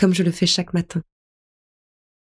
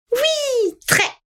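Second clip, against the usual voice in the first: put-on very high, eager little boy's yes; boy answering grandma about breakfast, very high and eager; eager child. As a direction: jump at it like a hungry kid. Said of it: "s'enthousiasme-t-il".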